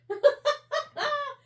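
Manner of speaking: high-pitched giggle. Real, not acted. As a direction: burst into a giggle. Laughing at lost glue.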